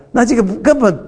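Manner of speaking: normal talking voice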